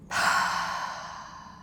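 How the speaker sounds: long audible sigh out through the mouth; big sigh. Shown as a demonstration. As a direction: let a big breathy sigh go. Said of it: "Sounds like this".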